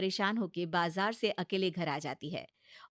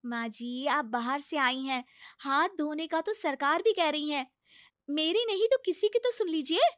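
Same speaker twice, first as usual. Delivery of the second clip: higher, coaxing bahu voice; pleading daughter-in-law; 6 semitones higher, 7 dB louder than usual. Acted. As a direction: talk sense into a stubborn mother-in-law. (ऊँची, मनाती हुई बहू की आवाज़)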